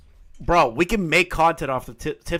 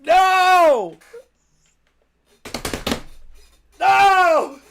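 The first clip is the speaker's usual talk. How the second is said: very high, loud wail of no; anguished no; 7 dB louder than usual. Pretend. Streamer mock-despairs at deleted messages, wailing high. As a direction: wail it like all is lost.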